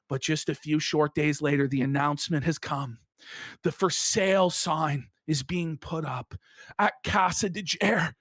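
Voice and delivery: low, grave mock eulogy; mock-solemn narration. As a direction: drop low and intone the bad news. Mock-solemn eulogy of rival's house.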